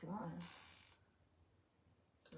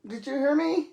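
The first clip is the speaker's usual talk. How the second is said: loud call to another room; yelling across the room; 6 semitones higher, 19 dB louder than usual. Real, not acted. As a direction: shout it up from upstairs. Calling across the house.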